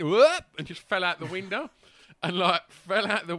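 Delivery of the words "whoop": a high, loud whoop as he falls. Retold or imitated. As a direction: let out a big falling whoop. Ant falling out the window.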